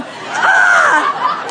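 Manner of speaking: loud, high "ah!"; goading scream. Retold or imitated. Scarer screaming at the cornered star, goading. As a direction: scream to make them scream. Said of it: "Come on!".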